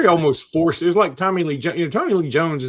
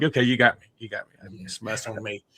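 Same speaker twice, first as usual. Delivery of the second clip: lower, conceding defeat; sheepish concession; 5 semitones lower than usual. Retold or imitated. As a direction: own the blame sheepishly. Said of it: "I was like".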